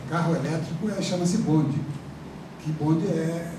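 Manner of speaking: normal talking voice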